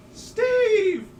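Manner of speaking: high, loud, drawn-out wail of complaint; drunken yelling. Retold or imitated. Man mimicking his drunk friend at the bedroom door, wailing loudly. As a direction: yell in drunken outrage through a door.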